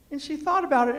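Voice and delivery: ordinary delivery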